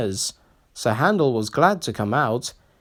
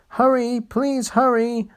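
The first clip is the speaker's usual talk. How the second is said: urgent plea; 11 semitones higher than usual. Acted. Engine urging himself on.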